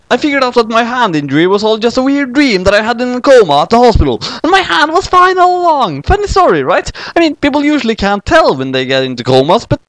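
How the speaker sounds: a hint of a sheepish boy; lightly voiced boy. Kyosuke telling Madoka about his coma, lightly voiced boy.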